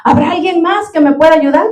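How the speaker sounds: the dangling climber, refusing to let go, shouts for someone else; mock shout for help. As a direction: cry out to anyone else up there. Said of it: "empieza a gritar".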